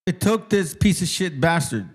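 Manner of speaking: ordinary delivery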